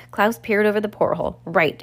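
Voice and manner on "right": ordinary delivery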